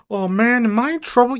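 put-on high, pleading boy-king voice; whiny Ptolemy. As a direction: plead like a clueless preteen. Ptolemy worried.

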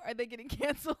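ordinary talk, nothing marked

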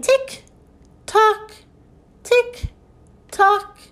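high, evenly spaced tick-tock; high-pitched mechanical clock imitation. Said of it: "sound like a clock".

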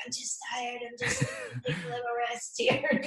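high, soft, wispy mock voice; mock feeble player. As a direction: imitate a lazy player pretending to be hurt. Injured player lying there, mocked.

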